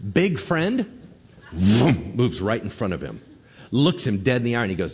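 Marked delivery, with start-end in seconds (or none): none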